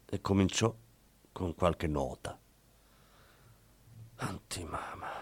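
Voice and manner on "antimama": sospirato. A narrator's reading. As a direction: breathe it out like a quiet sigh. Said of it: "sospirò Stucchi".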